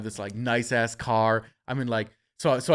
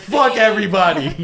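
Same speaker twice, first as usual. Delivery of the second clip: loud, higher, laughing defiance; laughing triumphant; 7 semitones higher, 7 dB louder than usual. Real, not acted. Quoting his defiant thought at the story's climax, loud and laughing. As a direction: toss it out, gleeful.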